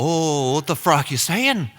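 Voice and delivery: put-on Irish accent, building to a high shout; hammed-up Irish indignation; unamused Irishman. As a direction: take offense in a put-on Irish accent, escalating. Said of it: "like, not amused at all".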